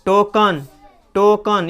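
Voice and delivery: टोकन as "TOH-kun", schwa in the end; teacher's model टोकन, ending "-kun"; correct pronunciation. Shown as a demonstration. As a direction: say टोकन with a short "uh" before n. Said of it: "टोकन, ना कि टोकन ना पढ़ेंगे".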